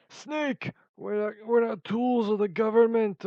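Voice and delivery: high, loud game-character voice; Gray Fox. Acted. Vlogger retrying a game impression, high dramatic voice. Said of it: "like, Gray Fox from Metal Gear Solid".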